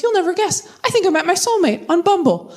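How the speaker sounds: high, gushing news; gushing friend. Retold or imitated. Friend announcing her soulmate on the phone, high and gushing. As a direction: spill the big news breathlessly. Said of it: "She goes".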